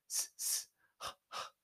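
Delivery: rhythmic unvoiced breaths; panting while running. Tomohiro jogging along the highway, rhythmic panting. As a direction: breathe in-in, out-out as you jog.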